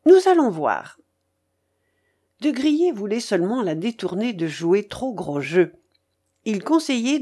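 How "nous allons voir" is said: raised, louder challenge; brisk commanding follow-up; ordering. A narrator's reading. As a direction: lay down the order firmly. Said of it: "m'ordonna-t-elle".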